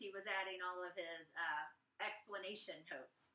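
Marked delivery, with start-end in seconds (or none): none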